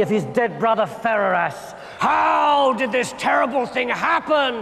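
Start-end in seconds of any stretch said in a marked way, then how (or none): 2.0-4.6 high, hammed-up wail of grief; tearful cry. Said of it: "he cried".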